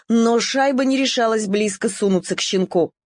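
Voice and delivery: usual speaking voice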